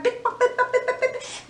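fast, high pick-pock patter; running footsteps voiced high; quick heel clicks. Shown as a demonstration. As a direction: rattle off the running heels. Lady running in heels.

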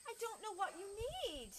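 high, regretful, emphatic apology; apologetic librarian. Acted. Librarian tells the goat she can't help, apologetic. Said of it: "looked at the poor goat".

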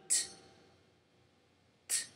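T said as a short crisp t; demo ta sound. Shown as a demonstration. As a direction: isolate the T sound cleanly. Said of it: "The sound in the ta will be like this".

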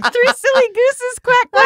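high loud silly goose chant; silly clown. Acted. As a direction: quack it out like a cartoon goose. Sing-song goose joke.

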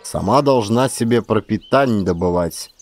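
ordinary delivery, nothing marked

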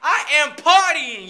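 half-joking pastor voice; mock preaching. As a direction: build it like a sermon. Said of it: "I feel like a pastor right now".

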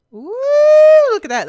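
loud, high delighted ooh; high squeal of delight; excited ooh. Real, not acted. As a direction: burst out in thrilled wonder. Spots construction outside window.

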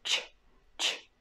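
ch said as soft ч, quiet; soft ч sound. Shown as a demonstration. Teacher demonstrates soft ch to students. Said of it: "always soft sound ch".

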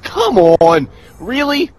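loud, high exasperated yell; raging gamer. Real, not acted. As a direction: shout in disbelief. Player killed again.

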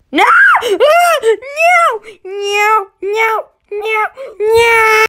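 high, loud cries of no; panicked screams. Real, not acted. Player screaming no as the giant drops him. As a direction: scream as you fall.

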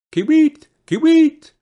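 high, loud, piping bird cry; bird call. A narrator's reading. Bird singing from the juniper tree, high, loud call. Bird's call within its song.

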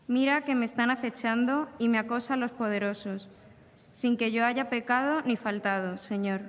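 normal talking voice

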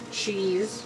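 ordinary talk

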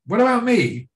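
high-pitched mock-aggrieved outcry; jealous complaint. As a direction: act out a jealous protest, pitch jumping up. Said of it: "a jealous feeling".

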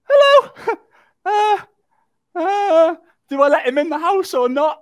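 very high, loud nervous squeal; hammed-up falsetto panic; mock terrified squeak. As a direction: squeak out a greeting like you're petrified. Acts out his frightened squeaky reply.

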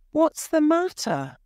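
high, concerned voice; concerned question. A narrator's reading. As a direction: ask what stopped him. Miles asks startled Edward.

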